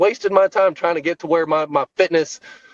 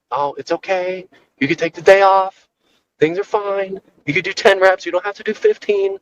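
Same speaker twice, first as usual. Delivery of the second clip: higher, coddling mom voice; mommy voice; 5 semitones higher than usual. Retold or imitated. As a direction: soothe like a mom excusing a skipped workout. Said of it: "was your mommy voice coming out".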